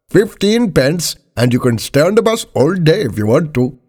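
high-pitched bus driver laying down the rule; firm driver, pitched high. Acted.

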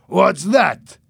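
loud, high, sharp question; angry demand. A narrator's reading. Boss pointing out of the plane window, angry.

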